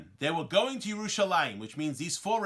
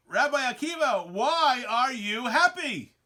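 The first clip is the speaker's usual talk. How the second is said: retold bewildered question, higher and drawn out; baffled questioning; 6 semitones higher, 4 dB louder, slower than usual. Rabbis asking Rabbi Akiva why he is happy, baffled. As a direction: slowly plead for an explanation, pitched up.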